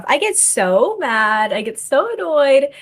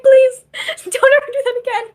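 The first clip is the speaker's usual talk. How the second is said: mock horrified plea; 6 dB louder than usual. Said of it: "I was like".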